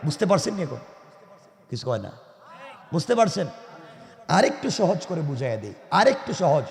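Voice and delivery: normal talking voice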